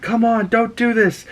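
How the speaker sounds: high, pleading voice; pleading Jean. Retold or imitated. Jean pleading with Cable as he attacks, high and pleading. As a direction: beg him to stop. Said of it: "Jean's like".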